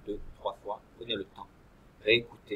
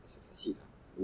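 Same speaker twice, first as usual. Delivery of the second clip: whispered aside; 9 dB quieter than usual. Real, not acted. Teacher reassuring aside.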